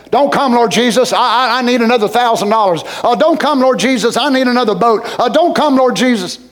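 hammed-up plea to hold Jesus off; mock reluctant prayer. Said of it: "What am I gonna say?".

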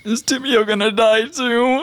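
high, tearful little wail; teary. Acted. Dave looks up at Uli with teary eyes, tearful. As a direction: ask it close to tears, hammed up. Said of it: "like, teary eyes".

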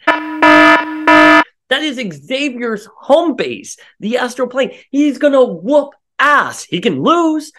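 high, loud, slow outraged ranting; fired-up fan fury; heated rant. Real, not acted. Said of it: "I'm so angry".

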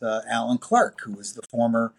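ordinary delivery

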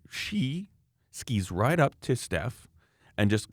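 ordinary delivery

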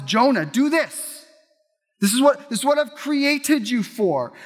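a preacher voicing God's firm summons to Jonah; commanding God. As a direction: call Jonah to his purpose with authority.

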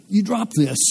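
high, surprised call-out. Retold or imitated. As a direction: point out the dropped money in surprise. Telling a man he dropped money.

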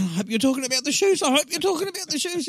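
high, loud mock panic; comic alarm. Acted alarm at double meaning.

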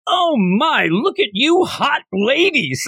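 high, slow leering flirt voice; sleazy flirt. Retold or imitated. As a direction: lay on the creepy charm, slowly. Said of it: "He's like".

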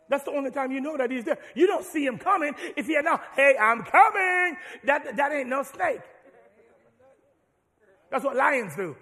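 loud, high, full-force preaching; preaching hard. Real, not acted. Preacher warning the church about the snake, loud and high. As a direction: drive the point home at full voice.

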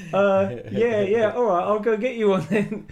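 mock meek surrender; caving salesman.

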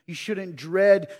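"dread" drawn out slowly and loud; slow stress on dread. Acted. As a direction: lean hard on "dread", slowly. Preacher hammers the word again.

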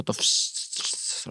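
soft unvoiced hiss of running water; imitating the shower water to listeners, soft hiss. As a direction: breathe out a quiet shhh like the shower.

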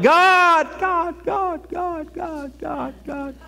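cries God high, then fades to almost nothing; calls out in desperation, then trails off; desperate cry fading. Retold or imitated. Praying alone in a cave, calls out and fades. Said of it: "You're like".

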